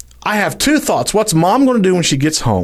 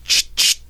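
shotgun pump sound; 4 dB quieter than usual. Retold or imitated. Imitating shotgun pump again.

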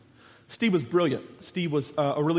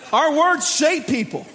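louder and higher, slow, hammering it home; emphatic shout; 6 semitones higher, 4 dB louder, slower than usual. Real, not acted. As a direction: preach it out loud. Preacher driving point home.

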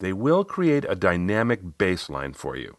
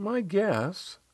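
small, much higher client voice, softer; Riley; 12 semitones higher, 6 dB quieter than usual. Retold or imitated. Client accepting coach's compliment, high and softer. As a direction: concede it modestly in a high, soft voice. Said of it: "She gave a little smile and said".

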